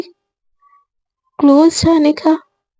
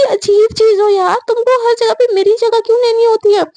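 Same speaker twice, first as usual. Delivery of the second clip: higher-pitched exasperated complaint; acted bickering, pitched up; quarrelling; 8 semitones higher than usual. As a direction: accuse a rival, fed up. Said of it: "इन लोगों के झगड़े से".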